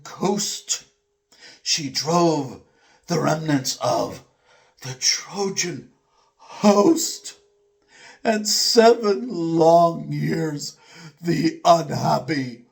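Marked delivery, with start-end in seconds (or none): none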